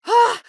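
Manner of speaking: sharp, high, loud cry of pain. Acted. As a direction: yelp as the eye stings. Eye stinging in combat.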